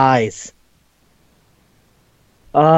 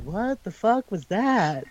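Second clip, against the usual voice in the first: stunned mutter; 4 semitones higher, 8 dB quieter, slower than usual. Retold or imitated. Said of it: "the people behind her are like".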